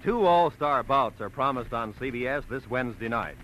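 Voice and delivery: ordinary talk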